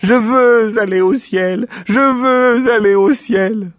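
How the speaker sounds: lent, aigu, fort, en pleurs; sanglots du cochon. A narrator's reading. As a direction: brailler en sanglotant. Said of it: "en sanglotant". (caprice du cochon qui sanglote)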